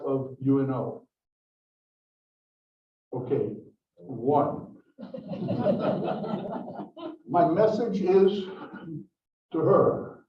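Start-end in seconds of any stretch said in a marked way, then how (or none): 5.0-7.2 light laugh. Real, not acted. Only one professor raises hand.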